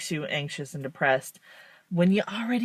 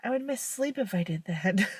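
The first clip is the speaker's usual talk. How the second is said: softer, a touch higher, mock excuse; softer reply; 4 semitones higher, 6 dB quieter than usual. To friends, a soft excuse about needing sleep. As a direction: murmur the excuse. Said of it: "And I'm like".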